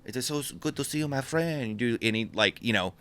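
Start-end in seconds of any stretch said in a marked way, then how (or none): 0.0-1.8 higher, softer mock French greeting; awful French accent. Excitable guy greets his friend, in awful French accent. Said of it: "Awful French accent".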